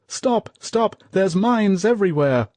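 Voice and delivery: high, loud warning shout; yell. A narrator's reading. Soldier warning comrades of mines, loud yell. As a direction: scream the warning. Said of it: "someone yelled".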